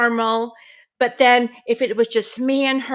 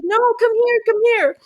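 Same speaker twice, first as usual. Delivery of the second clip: higher, pleading come here; urgent call; 10 semitones higher, 3 dB louder, faster than usual. Retold or imitated. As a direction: pull her back, embarrassed. Teen urgently calling grandma back.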